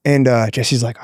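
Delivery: ordinary talk, nothing marked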